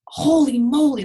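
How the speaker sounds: bright, loud "holy moly"; excited exclamation. Retold or imitated. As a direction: blurt it out in delight.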